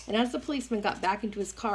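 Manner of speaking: plain reading voice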